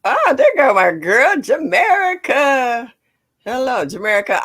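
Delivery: high, loud, delighted hello, name drawn out slowly; light up when you see her name; excited greeting. Real, not acted. Spots a favorite viewer in chat.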